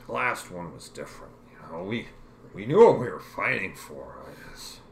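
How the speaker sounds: lightly voiced war reporter, booming; pompous reporter voice. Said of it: "to Margaret, he's saying".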